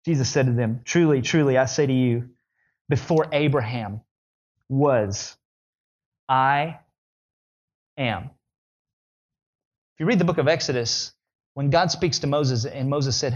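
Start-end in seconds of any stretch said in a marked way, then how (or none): 0.8-8.3 slow, spaced words with long pauses before am; Jesus' solemn claim. Acted. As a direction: let each word land. Solemn climactic quote, slow pauses.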